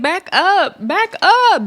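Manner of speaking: rising, high-pitched mock yell; imitating a fighter shouting to back off. Scotty yelling at Big Lex.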